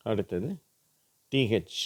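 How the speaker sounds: normal talking voice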